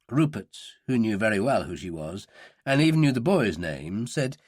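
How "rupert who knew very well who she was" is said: ordinary delivery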